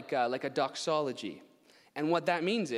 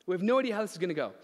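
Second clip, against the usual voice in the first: anxious rush; 7 semitones higher, 5 dB louder, much faster than usual. Retold or imitated. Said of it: "trust to be like".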